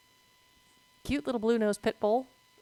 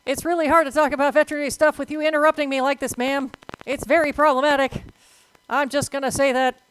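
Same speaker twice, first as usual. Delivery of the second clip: loud, higher-pitched complaint to a pest; mock scolding; 5 semitones higher, 8 dB louder than usual. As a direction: play at telling someone off, loudly. Scolding the boss for interrupting her.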